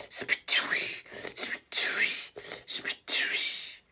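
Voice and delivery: whispered spitting noise; spitting sound. Pretend. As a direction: spit them into space.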